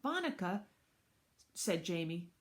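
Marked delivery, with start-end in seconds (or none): 0.0-0.6 put-on high small-child call; little boy. Little brother calling his babysitter, high child voice. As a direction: call her in a little kid's voice.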